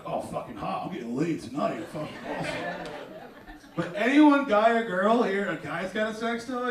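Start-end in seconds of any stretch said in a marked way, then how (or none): 0.0-2.5 lower, softer dopey bro voice; horny bro. Retold or imitated. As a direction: mumble like a thrilled dumb bro. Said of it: "you're like".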